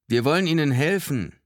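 high, loud public appeal; megaphone voice. A narrator's reading. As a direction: project it as through a megaphone. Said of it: "ertönte es aus dem Megafon".

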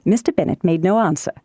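ordinary delivery, nothing marked

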